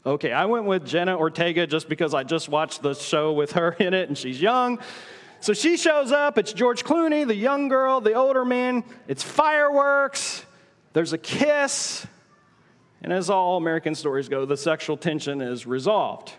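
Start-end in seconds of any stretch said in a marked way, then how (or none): 5.4-12.0 mock excited. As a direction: ham up the romance like gossip, slowing on the kiss. Mocking an American romance plot.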